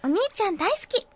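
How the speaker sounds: put-on high, sweet anime girl voice with a rise; second try at a cute anime heroine. Shown as a demonstration. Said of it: "ちょっと違うよね".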